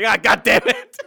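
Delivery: exasperated laughing outburst; laughing frustration. Real, not acted. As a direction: curse through a laugh. Flubs the name again.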